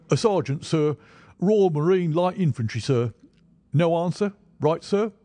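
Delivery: loud, high barked soldier report; stiff barking toy soldier. A narrator's reading. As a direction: snap it out like on parade. Sergeant reports to Holmes.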